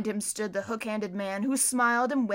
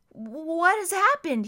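put-on higher, slow, dazed boy's voice; bewildered boy; 7 semitones higher, 5 dB louder, slower than usual. A narrator's reading. Klaus asking Violet, slow and bewildered. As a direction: ask it half-awake and lost.

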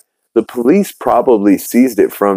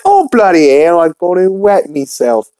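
screech-pitched put-on Cockney girl; Cockney accent; 9 semitones higher than usual. Eliza after wetting herself at the party, Cockney accent. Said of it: "goes right back to her Cockney accent".

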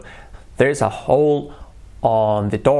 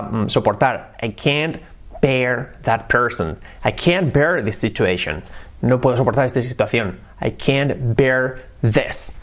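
ordinary delivery

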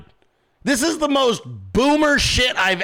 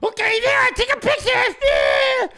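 mock assistant. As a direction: ham up a clueless assistant posing. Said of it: "assistant, like".

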